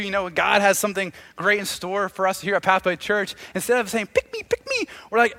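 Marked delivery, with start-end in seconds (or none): 4.1-4.9 high-pitched eager chirp; pick-me energy. Acted. Believers volunteering for God, high eager chirp. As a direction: pipe up begging to be chosen. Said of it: "the energy we bring".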